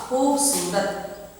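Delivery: खूबसूरत stretched slowly on the long ू; drawn out. Shown as a demonstration. Teacher models खूबसूरत's long vowel, very slowly. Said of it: "इस तरह से पढ़ेंगे".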